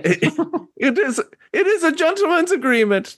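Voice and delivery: put-on old woman voice.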